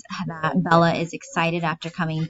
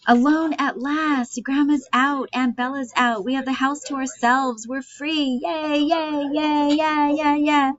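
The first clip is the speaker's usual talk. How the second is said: gleeful cry of freedom; jubilant yelling; 9 semitones higher than usual. Acted. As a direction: celebrate with drawn-out high yays. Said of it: "Artie, yelling".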